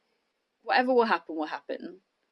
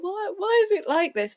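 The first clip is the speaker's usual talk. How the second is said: anguished cry; 11 semitones higher, 3 dB louder than usual. Retold or imitated. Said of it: "you're like".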